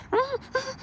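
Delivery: very high, soft whimpering whine; imitated dog whine. Showing how the dog sounded meeting another, whining. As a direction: imitate a dog's squeaky whine. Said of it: "he was, like, whining".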